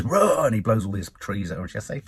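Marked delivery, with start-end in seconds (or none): 0.0-0.5 loud mock monster roar; imitated roar. Reviewer imitating the stranger blowing trees at her, mock roar. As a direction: let out a raised, drawn-out roar. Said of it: "he goes".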